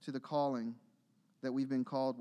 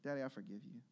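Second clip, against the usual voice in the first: put-on soft child's forgiveness; 3 semitones lower, 8 dB quieter, faster than usual. Son's reply retold.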